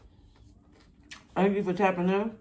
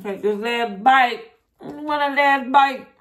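slow, louder, higher savoring; drawn-out savoring; 6 semitones higher, 4 dB louder, slower than usual. Real, not acted. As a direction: stretch out the last bite moment. Savouring the final bite.

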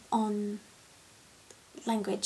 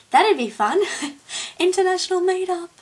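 excited; 9 semitones higher, 10 dB louder than usual. Real, not acted. Excited about meeting viewers.

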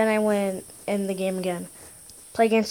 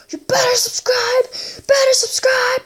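high, strained put-on voice; mock threat; 14 semitones higher than usual. Gamer telling viewers to subscribe, high mock threat. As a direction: demand it in a squeezed high voice.

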